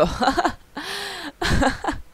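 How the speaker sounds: high burst of laughter; hearty laugh. Real, not acted. Streamer reacting to a friend in chat, high hearty laughter. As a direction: crack up at a chat message.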